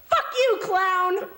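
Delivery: high-pitched yell; shouted comeback. Acted. Kenny finally retorts to the clown, shouting high. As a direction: yell it out as the big punchline.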